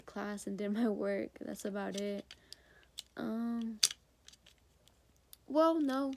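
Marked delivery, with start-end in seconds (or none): none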